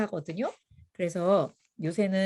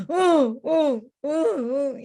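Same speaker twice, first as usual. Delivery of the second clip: slow drawn-out sobbing "응", louder; imitating a crying toddler; 5 semitones higher, 5 dB louder, much slower than usual. 울던 아이가 선생님에게 흐느끼며 대답하듯. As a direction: whimper each 응 like a toddler in tears. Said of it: "이러면서 계속 우는 거예요".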